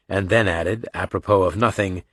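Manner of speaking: ordinary delivery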